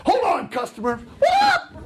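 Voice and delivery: high mock yell at the customer; mock shout. Busy clerk hollering at a customer, mock shout. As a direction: ham up a rude clerk's holler.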